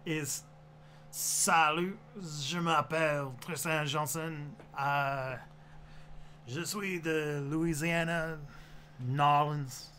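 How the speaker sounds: slow, tentative Louisiana French; careful Cajun drawl in French; Cajun French accent. Shown as a demonstration. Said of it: "Do Cajun in French".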